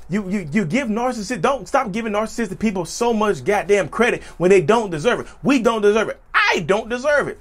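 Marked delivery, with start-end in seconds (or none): 6.3-7.4 high emphatic crack on "I". Real, not acted. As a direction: voice jumps up and breaks in protest. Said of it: "My voice cracked".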